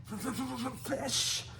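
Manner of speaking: very high sputtering dragon hiss. A narrator's reading. Toothless demands food after biting Hiccup, hissing. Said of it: "said Toothless again".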